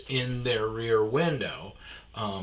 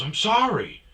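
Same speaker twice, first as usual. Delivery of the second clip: exasperated apology; 10 semitones higher, 7 dB louder than usual. Retold or imitated. Driver's acted repeated apology.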